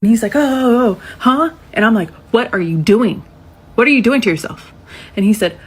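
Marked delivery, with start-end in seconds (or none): 0.4-1.5 high, loud flustered "oh, oh"; mock startled boy; caught off guard. 2.3-4.7 louder, firm repeated question; stern questioning of the boy; stern demand. Retold or imitated. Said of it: "I'm not mean, but I'm stern".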